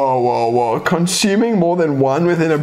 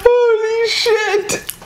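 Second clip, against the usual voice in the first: shocked; slower than usual. Real, not acted. Shocked by toxic candy.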